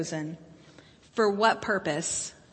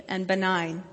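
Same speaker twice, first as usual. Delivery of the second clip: ordinary delivery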